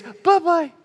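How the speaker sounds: high, sing-song bye-bye; cheeky goodbye. Acted. God cheerfully sending the child over the waterfall, high sing-song. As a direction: wave them off playfully.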